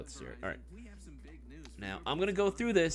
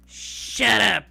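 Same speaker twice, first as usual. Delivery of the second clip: sharp command; 7 semitones higher, 10 dB louder than usual. Real, not acted. Telling chat to stay quiet.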